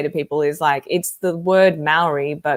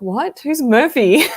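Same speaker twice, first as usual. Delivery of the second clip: laughing, rising "What? Who's Murphy?"; bewildered, higher and louder into a laugh; retold confusion; 8 semitones higher, 4 dB louder, slower than usual. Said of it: "I was like".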